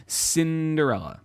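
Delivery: ordinary delivery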